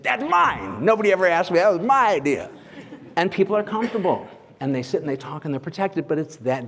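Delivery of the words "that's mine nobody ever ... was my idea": high, animated outburst claiming credit; emphatic retold exclamation, pitched well up; Charlie Rose imitation. Talk show host answers whose idea the table was, excited imitation. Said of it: "And he said".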